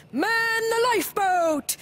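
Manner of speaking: high, slow, loud call; shouting the order. Acted. Granddad calls the crew to the lifeboat, shouting. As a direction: bellow it across the boat.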